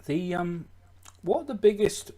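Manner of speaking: talking while chewing; words round a bite of food; mouth full. Real, not acted. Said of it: "Are you eating again".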